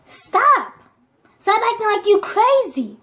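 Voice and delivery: high, exclaimed mock protest; imitated protesting dad. Dad putting his hand out to stop mom, mock protest. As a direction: act out a father stepping in.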